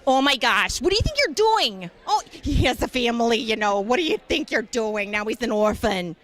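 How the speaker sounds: fussy, scolding mother voice, pitch leaping up; a hammed-up Paul Lynde style guilt trip; character voice scolding a gamer for a headshot.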